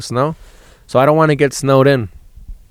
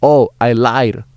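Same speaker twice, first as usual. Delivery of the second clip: smug comeback; 2 semitones lower, much slower than usual. Pretend. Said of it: "I'm gonna say".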